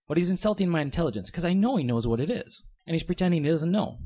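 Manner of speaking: normal talking voice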